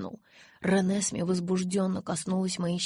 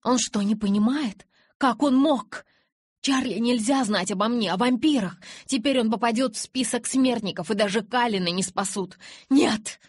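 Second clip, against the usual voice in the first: louder, higher, rising panic; frantic alarm; 4 semitones higher, 6 dB louder than usual. A narrator's reading. Bella crying out in panic at Edward. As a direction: cry out in horror, voice climbing.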